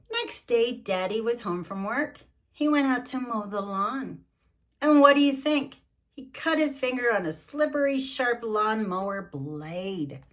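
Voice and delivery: loud, high storybook read; lively picture-book narration; reading to children. Acted. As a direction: read it big for the grandkids.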